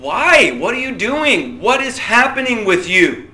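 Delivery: high, loud, incredulous demand; outraged questioning. Acted. Preacher confronting a wrongdoer, outraged. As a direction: confront them with exasperated emphasis.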